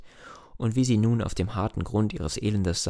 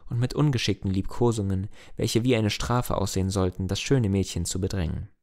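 usual speaking voice

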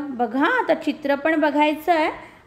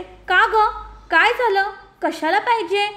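surprised mother; 6 semitones higher than usual. Acted. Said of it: "आईला आश्चर्य वाटतं".

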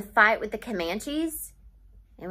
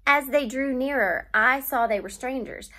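usual speaking voice

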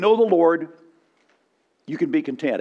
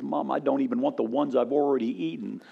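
put-on stuffed boy, barely talking; 3 semitones lower, 6 dB quieter than usual. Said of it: "he just barely can talk".

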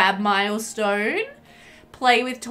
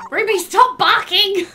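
loud, high scold; shouting at the sim dog; yelling at the dog; 8 semitones higher, 6 dB louder than usual. Real, not acted. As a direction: bark the order at the dog.

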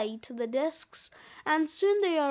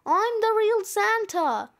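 higher, raised-voice claim; loudly insisting; insistent shout; 6 semitones higher, 4 dB louder than usual. A narrator's reading. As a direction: shout it out to outdo the others. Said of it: "shouts one of the Santas".

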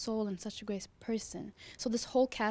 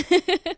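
laugh; 10 semitones higher, 14 dB louder than usual. Real, not acted. Laugh after joke.

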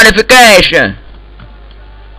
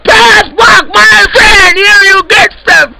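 high excited shout; 8 semitones higher than usual. Acted. Yelling during the firefight.

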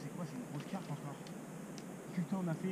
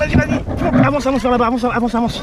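loud urging commands; shouting; 3 semitones higher, 15 dB louder than usual. Real, not acted. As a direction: shout them forward. Directing friends loudly.

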